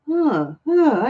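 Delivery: high breathy huh huh, caught off guard; surprised huffing laugh. Real, not acted. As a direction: gasp out a startled huh. Surprised reaction to card.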